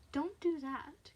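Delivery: creepy, unhurried caution; teasing menace. Acted. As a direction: draw it out tauntingly. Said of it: "teasing now, playing with her".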